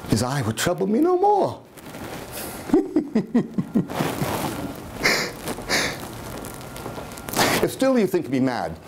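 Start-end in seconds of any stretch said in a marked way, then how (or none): 1.9-7.6 long, soft, unvoiced madman giggling; breathless mad giggle. Madman gloating that the eye is gone, long hushed giggle. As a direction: giggle under the breath like a gleeful lunatic.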